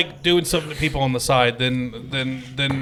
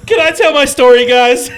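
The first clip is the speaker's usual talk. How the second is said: high, loud comic pleading; mock plea; 9 semitones higher, 7 dB louder than usual. Asking co-hosts to let a story be told, mock pleading. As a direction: cry out over the teasing, half laughing.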